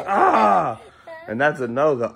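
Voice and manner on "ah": high, loud mimicked yell; imitated loud "ah". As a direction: copy the child's loud outburst. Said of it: "and then he goes".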